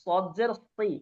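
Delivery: ordinary talk, nothing marked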